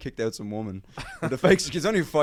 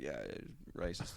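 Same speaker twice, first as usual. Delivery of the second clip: quiet agreement; 7 semitones lower, 14 dB quieter, slower than usual. Retold or imitated. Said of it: "the guy was like".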